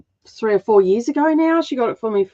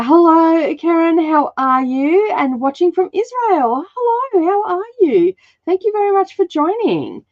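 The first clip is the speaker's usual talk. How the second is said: bright greeting; 10 semitones higher than usual. Real, not acted. Greeting live viewers.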